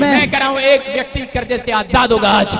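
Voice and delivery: loud, rising sermon proclamation; fiery pulpit declaration; full-force preaching. Acted. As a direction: preach it out to the whole hall.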